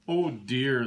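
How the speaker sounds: plain reading voice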